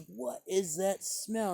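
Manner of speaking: retelling a woman sniffing at pipe smoke; disgusted lady. Retold or imitated. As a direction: drag it out like a snooty stranger. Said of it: "she goes".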